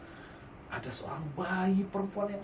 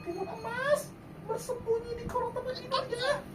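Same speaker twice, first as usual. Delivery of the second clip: whispering mother. Acted.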